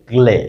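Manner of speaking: গ্লে as one syllable, g straight into le; pronunciation demo. Shown as a demonstration. Teacher contrasts it with ble and kle, pronunciation demo. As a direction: model গ্লে with a falling tune. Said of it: "গড় উচ্চারণটা just হবে গ্লে".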